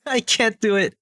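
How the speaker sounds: laughing high at herself. Real, not acted. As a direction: giggle through it. Laughs at failed impression.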